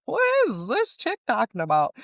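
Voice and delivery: mock her own past self, incredulous; a lightly voiced sneering sceptic; mock sceptical. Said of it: "I would be like".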